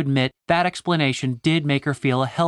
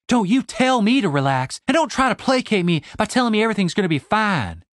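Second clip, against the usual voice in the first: angry; 8 semitones higher than usual. Acted. Stacy snaps at Tony.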